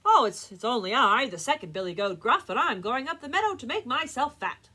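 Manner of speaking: loud, sturdier billy goat; not-so-small goat voice. Acted. Second goat answers the troll, loud middle-sized voice. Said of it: "his voice was not so small".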